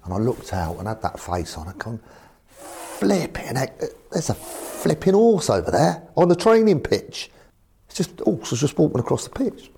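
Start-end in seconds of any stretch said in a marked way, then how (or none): 2.5-7.3 high, slow "flipping heck" in disbelief; shocked exclamation. Retold or imitated. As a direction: blurt it out, amazed at a horse on the pitch. Manager retells shocked exclamation.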